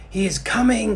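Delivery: high, loud excited call. A narrator's reading. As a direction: announce it in a rush. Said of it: "called one stepsister".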